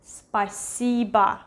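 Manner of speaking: "Спасибо" slowly as spa-SEE-ba; "Спасибо" slow, final O sounding like A; stressed middle syllable. Shown as a demonstration. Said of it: "E is stressed here".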